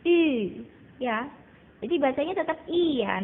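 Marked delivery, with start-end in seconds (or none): 0.0-0.2 U pronounced "ee" through pushed-out, rounded lips; loud ü sound for U; Mandarin ü. Shown as a demonstration. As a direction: pout forward and say "ee" for U. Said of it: "tapi bacanya I".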